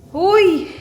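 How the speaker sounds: high, loud hey; calling out. Real, not acted. Calling to the child. (malakas na pagtawag)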